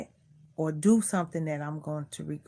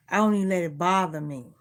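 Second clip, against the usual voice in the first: usual speaking voice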